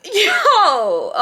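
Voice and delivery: loud, high, drawn-out yelp; one long stunned cry of disbelief; alarmed exclamation. Retold or imitated. Storyteller reacting to friend's gun threat, loud and alarmed.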